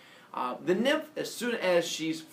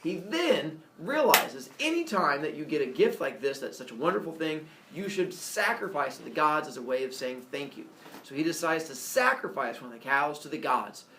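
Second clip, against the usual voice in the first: usual speaking voice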